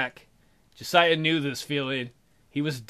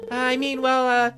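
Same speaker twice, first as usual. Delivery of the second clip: higher, awkward gentle letdown; awkward Emily; 8 semitones higher than usual. Acted. Emily friend-zones the bear.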